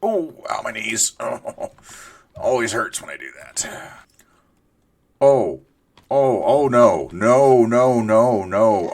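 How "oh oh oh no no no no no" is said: panicked no. Real, not acted. Being attacked in game.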